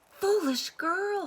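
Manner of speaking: high, slow groan of scorn; scornful mother's groan. A narrator's reading. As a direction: drag it out, exasperated. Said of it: "her mother groaned".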